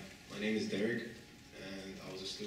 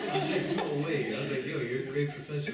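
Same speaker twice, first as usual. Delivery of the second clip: loud, higher voice full of laughter; amused, raised voice coming out of laughter; laughing protest; 6 semitones higher, 11 dB louder than usual. Real, not acted. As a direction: protest while still cracking up. Laughing through retold protest.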